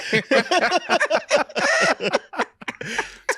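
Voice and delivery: long, high burst of laughter; hearty laugh. Real, not acted. Joking about the guy caught playing, hearty laughter. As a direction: crack up helplessly.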